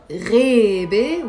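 "rebe" with the first sound gargled, first vowel drawn out. Shown as a demonstration. Teacher introducing the word for forest, slow gargled "rebe". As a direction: stretch "rebe" from a throaty gargled start. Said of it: "Gargling is G H".

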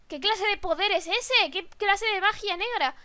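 normal talking voice

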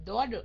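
"Daughter" with the T said as D; American D for T. Shown as a demonstration. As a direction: soften the T of "Daughter" into a D. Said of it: "đọc chữ T thành chữ D".

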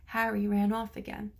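usual speaking voice

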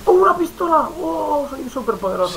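high, overexcited gamer glee; mock excitement. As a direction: ham up the thrill of a new gun. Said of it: "Y al principio". (emoción exagerada de burla)

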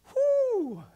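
loud, high hooted whoo; high whoop. Retold or imitated. Student bursting back into the room, high whoop.

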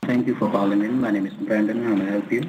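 usual speaking voice

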